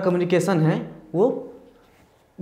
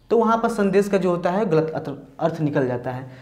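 usual speaking voice

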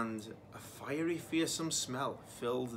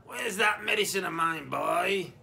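higher, louder nagging granny call; crying out, grandma; 5 semitones higher, 7 dB louder than usual. Acted. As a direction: shout from the next room like a cross grandma. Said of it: "cried the voice from the living room".

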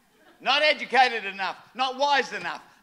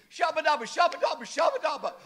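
imitating tongues; 4 semitones higher, faster than usual. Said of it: "ran out the door speaking".

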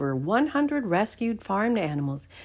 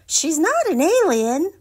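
much higher, louder scoffing voice; snorting little goat; 12 semitones higher, 7 dB louder than usual. Acted. Little Dude mocking Stanley, high and scoffing. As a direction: scoff like a cheeky little kid. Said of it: "Little Dude snorted".

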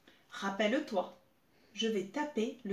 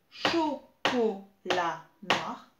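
chocolat noir slow and louder, cho-co-lat-noir; syllable by syllable; 4 semitones lower, 4 dB louder, much slower than usual. Shown as a demonstration. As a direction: clap out chocolat noir in four slow beats. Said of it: "c'est quatre syllabes". (chocolat noir scandé en quatre syllabes)

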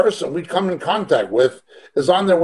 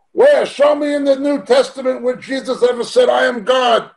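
challenging shout; 6 semitones higher than usual. Retold or imitated. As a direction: call it out like a dare.

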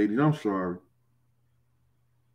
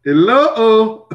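a sudden high, loud hello; mock shocked hello; 10 semitones higher, 8 dB louder than usual. Real, not acted. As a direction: act caught off guard by your own joke. Reacting to his own racy joke.

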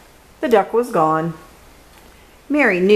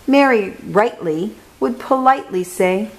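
plain reading voice

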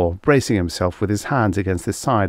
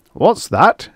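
loud, higher sharp shout; 10 semitones higher, 5 dB louder than usual. A narrator's reading. Colonel shouting at Jessup's strange words over radio. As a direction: snap it out in disbelief. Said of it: "shouted the Colonel".

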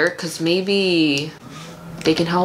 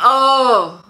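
harsh vocal fry groan; strained fry attempt; 5 semitones higher, 4 dB louder than usual. Real, not acted. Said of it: "that hurts already".

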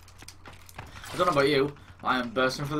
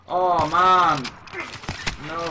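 loud, high, drawn-out groan; big exasperated exclamation; dismayed cry; 6 semitones higher, 9 dB louder, much slower than usual. Real, not acted. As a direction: wail it out in frustration. Dismay at dying in game.

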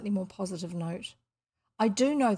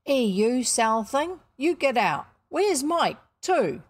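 louder, emphatic shooing in Ellen's voice; raised working-woman's scolding call; calling out sharply; 5 semitones higher, 7 dB louder, slower than usual. Acted. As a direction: order them out and call for the missing one. Ellen shoos and calls for pets.

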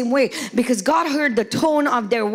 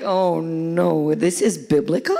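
much lower, mock-dismayed "oh no"; dismayed husband; 8 semitones lower, slower than usual. Husbands in the pews realizing tone matters, mock dismay. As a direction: play a husband caught out, groaning. Men reacting to sermon on tone.

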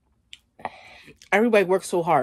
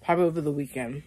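ordinary talk

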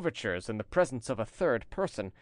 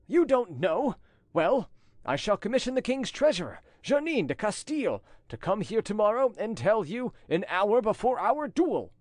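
higher, indignant challenge; threatening commander; 9 semitones higher than usual. A narrator's reading. Commander threatens madame with a duel, indignant. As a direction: throw her denial back, then lay out the threat.

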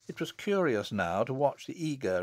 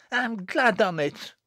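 high, drawn-out gloating; gleeful, laughing; 10 semitones higher, 4 dB louder than usual. A narrator's reading. Jennet pleased at Susan weeping, gleeful. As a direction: gloat over her tears, slowly.

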